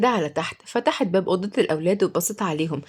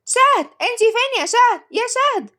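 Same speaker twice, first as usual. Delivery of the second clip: calling out; 5 dB louder than usual. A narrator's reading. Child calling out for nanny.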